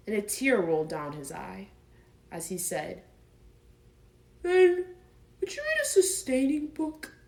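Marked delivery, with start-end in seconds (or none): 4.4-7.1 high, pleading bear voice; stuck bear begging for a book, higher; tearful Pooh plea. Acted. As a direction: ask for comfort through a tear.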